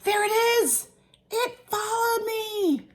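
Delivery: very high, loud shriek; drawn-out high shriek of fright; terrified scream. A narrator's reading. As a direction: scream it out in storybook panic. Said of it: "Cowboy McCoy screamed".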